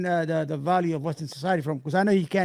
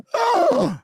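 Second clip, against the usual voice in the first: excited gasp; 12 semitones higher, 5 dB louder than usual. Real, not acted. Reacting to his Jets winning.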